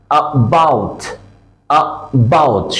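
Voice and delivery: about split as a tiny "uh", then "BOUT"; quick uh, brief gap, full falling "bout" in about; very short schwa. Shown as a demonstration. Teacher models about, first sound very short. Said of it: "খুব short".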